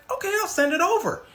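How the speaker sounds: high-pitched Sarah voice; agreeable employee. Retold or imitated. Sarah agrees to send the scammer money, high voice.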